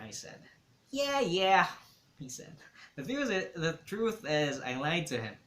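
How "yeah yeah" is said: louder, higher teasing yeah yeah; mock dismissive echo. A narrator's reading. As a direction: echo him back, playful. Dante echoes mockingly.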